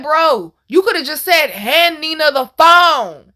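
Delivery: high, loud, stretched-out complaint; exasperated protest. Retold or imitated. Daughter scolding father over the phone call, exasperated. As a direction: protest loudly, dragging out the last words.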